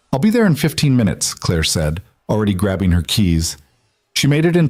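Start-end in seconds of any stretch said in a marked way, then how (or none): 0.1-1.3 fast, urgent promise. Acted. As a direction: rush the words out. Urgent rush to school.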